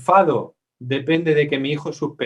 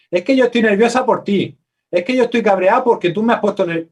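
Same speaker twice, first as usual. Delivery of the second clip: raised, loud voice, upset and blaming; angry blaming complaint; 6 semitones higher, 5 dB louder than usual. Acted. A partner loudly blaming the other for their anger. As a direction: snap at a partner for making you nervous.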